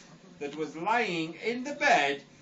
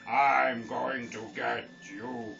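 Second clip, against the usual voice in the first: slow, low menacing wolf threat; a mild growl of a chasing wolf; big bad wolf; 6 semitones lower, slower than usual. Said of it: "Who's doing the voice?".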